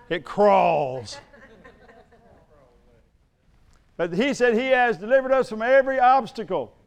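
loud, ringing pulpit delivery; fiery sermon voice; preaching at full force. Acted. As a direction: preach it out to the whole congregation.

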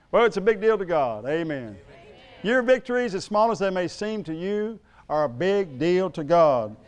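loud, ringing pulpit delivery; fiery sermon voice; preaching at full force. Acted. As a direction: preach it out to the whole congregation.

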